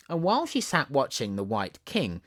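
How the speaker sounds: ordinary delivery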